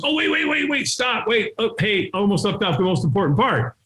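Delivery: high, hurried cutting-in; urgent interjection. Retold or imitated. Buddy stopping his friend before hanging up, urgent. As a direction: blurt out a last-second afterthought.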